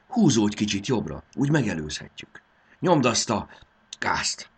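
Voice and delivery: high, loud, pushing instructions; eager, insistent orders; urgent coaching. A narrator's reading. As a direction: drive them on to overtake. Fairy urges driver to overtake.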